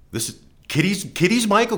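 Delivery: high-pitched urgent call to someone in the house; excited calling out. Retold or imitated. Neighbor calls out to grandmother about the caller, excitedly.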